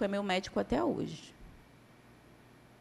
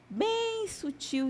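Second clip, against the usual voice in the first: "bem sutil" stretched very slowly and lifted much higher; drawn out softly; 10 semitones higher, much slower than usual. Shown as a demonstration. Preacher showing how subtle the devil comes, high and slow. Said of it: "ele vem bem sutil".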